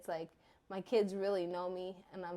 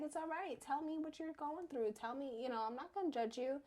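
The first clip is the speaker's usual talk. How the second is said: comforting; 8 semitones higher than usual. Retold or imitated. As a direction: gently coax her to open up. Mother comforting crying daughter.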